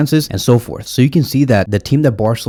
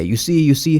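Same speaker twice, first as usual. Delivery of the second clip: mock-excited, pitched much higher; smug crowing fan imitation; gloating fans; 6 semitones higher than usual. Fans praising Valverde after a win, mock-excited crowing. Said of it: "Everybody was saying".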